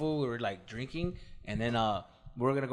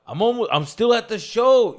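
phone excuse; 8 semitones higher, 9 dB louder than usual. Retold or imitated. Jerry lying to wife on phone.

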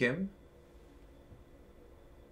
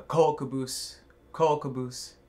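higher, louder retold call; imitated child's yell; 6 semitones higher, 4 dB louder, slower than usual. Sister retelling her little brother's game of trains, imitated yelling. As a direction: call out like an excited little brother, only half yelled. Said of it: "he kept yelling".

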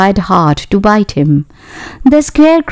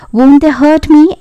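put-on higher-pitched worried girl's question; a touch of anxiety, pitched up; mildly anxious; 7 semitones higher than usual. A narrator's reading. Girl fears the monkeys may hurt her, mildly anxious. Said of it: "asked the girl anxiously".

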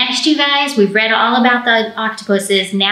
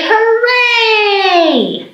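a long, high sung hooray; cheering hooray; 11 semitones higher than usual. Shown as a demonstration. Librarian showing the kids the hooray. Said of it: "we're gonna say".

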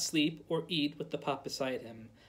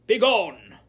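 a sudden furious shout, much louder; cried out; 4 semitones higher, 12 dB louder than usual. A narrator's reading. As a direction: bark it at the door. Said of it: "cried the son".

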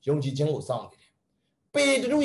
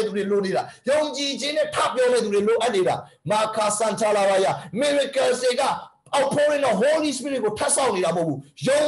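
fervent, rising preacher's cry; preaching at full force; 5 semitones higher than usual. Real, not acted. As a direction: preach it with fire.

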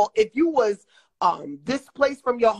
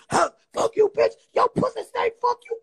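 mock Eric scream; 8 semitones higher than usual. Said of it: "he gonna scream".

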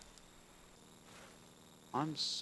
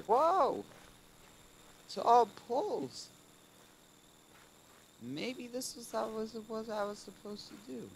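silly high voice; 12 semitones higher, 5 dB louder than usual. Pretend. Silly put-on voice.

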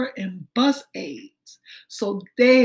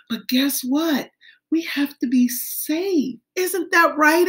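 ordinary talk, nothing marked

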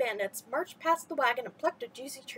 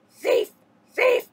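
high, very loud squawked cry; a fish crow shrieking a warning; crow's alarm cry; 11 semitones higher, 10 dB louder than usual. A narrator's reading. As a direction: caw it out like a crow raising the alarm.

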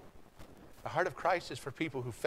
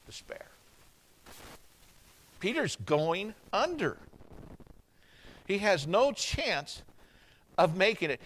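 ordinary talk

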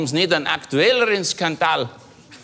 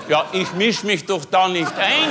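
normal talking voice

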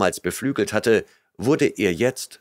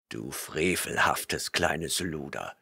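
low, slow, soft growl; scornful barbarian growl; growling Conan; 5 semitones lower, 8 dB quieter, slower than usual. A narrator's reading. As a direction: growl the insult down at her. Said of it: "grollte Conan".

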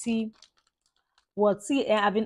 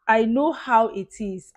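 emphatic; 3 semitones higher, 8 dB louder, slower than usual. Real, not acted. Repeats phrase with emphasis.

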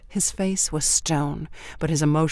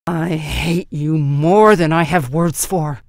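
growled; 9 dB louder, slower than usual. A narrator's reading. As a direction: growl it right at her. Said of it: "he growled".

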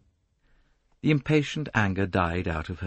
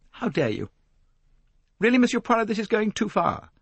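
indignant outburst; 14 semitones higher, 3 dB louder than usual. Acted. Lynette flares up at Poirot.

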